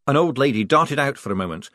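plain reading voice

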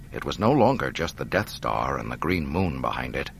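plain reading voice

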